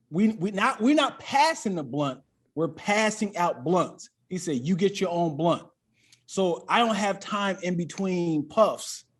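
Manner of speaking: ordinary talk, nothing marked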